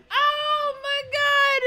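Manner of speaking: very high, slow squeal through laughter; helpless laughing. Real, not acted. Streamer overcome by own joke, squealing with laughter.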